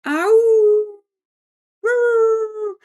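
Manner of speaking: high, loud drawn-out howl; werewolf howl. Retold or imitated. Showing a friend the sensor werewolf, loud imitated howl. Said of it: "we hear this".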